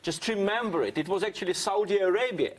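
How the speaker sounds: normal talking voice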